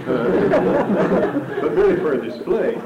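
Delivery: chuckling as the joke lands; amused laugh breaking into speech. Real, not acted.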